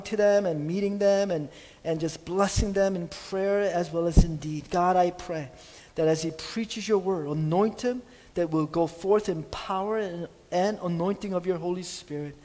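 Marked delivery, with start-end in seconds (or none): none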